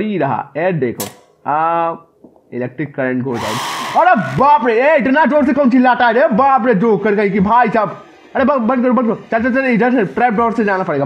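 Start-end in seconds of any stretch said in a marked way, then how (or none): 4.1-8.0 high, loud startled outburst then fast laughing complaint; startled yelp. Real, not acted. Player startled by a scream in the game, high loud yelp with a laugh. 8.4-11.0 urgent panic. Real, not acted. Fleeing the noise, urgent.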